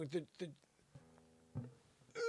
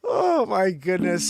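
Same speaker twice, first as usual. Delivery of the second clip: exasperated; 3 semitones higher, 4 dB louder, slower than usual. Real, not acted. Exasperated before resuming the news.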